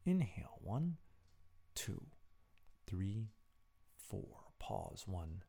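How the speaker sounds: soft, counted breathing guide; guided breathing. Acted. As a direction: count the breaths softly and calmly. Leads breathing exercise.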